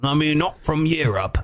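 hammed-up British accent; British accent impression. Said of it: "I like the one before".

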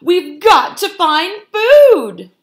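high, loud, drawn-out wail; desperate cry. A narrator's reading. Starving foxes giving up on farmyards, desperate loud cry. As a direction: wail it out at full voice. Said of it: "they cried".

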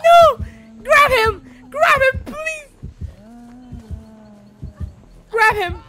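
high, loud frantic cries; panicked yelling. Real, not acted. Caught player screaming at teammate for help. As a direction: yell in real panic at the teammate. Panic while being chased.

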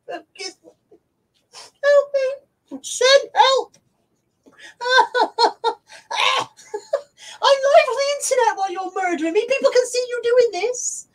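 mock distress. Real, not acted. Dog attacking her on stream.

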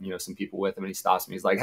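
normal talking voice

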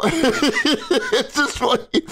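slow, high giggling; real laughter; laughing slowly. Real, not acted. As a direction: drag it out while laughing.

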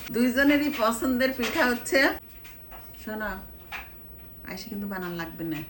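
usual speaking voice

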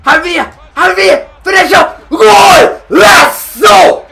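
high, loud goal celebration building from urging; excited shouting. Real, not acted. Gamer cheers Javier on to score, excited shouting. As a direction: urge the striker on, then explode.

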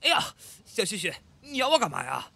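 high, loud shout of pain; pained outcry. Acted. Roommate being bitten yells at him, loud. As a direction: yell at him to let go.

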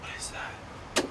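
slow, voiceless whisper; frightened whisper. Real, not acted. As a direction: barely breathe the question in fear. Seeing something in dark room.

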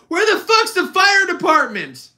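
high, loud mock yell in the theater; retold outburst. Friend jumping up in the movie, loud mock outburst. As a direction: blurt it out indignantly. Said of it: "and is like".

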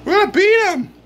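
high-pitched fan exultation; excited boast. Real, not acted. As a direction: crow it high, rallying the fans. Fan exulting over team's win.

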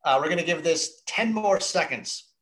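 ordinary talk

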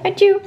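high playful fake sneeze; mock sneeze. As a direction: sneeze it out cutely for the baby. Nagedaan niesje voor baby. (speels nep-niesje)

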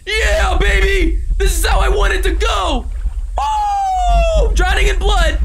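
loud, high-pitched hype shouting; exultant yelling. Pretend. Gamer hyping up own drowning in blood, exultant yelling. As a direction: belt it out in wild mock triumph.

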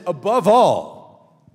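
very slow, drawn-out; a weighty, slow proclamation; emphatic quote. Acted. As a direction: stretch out above all. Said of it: "He says".

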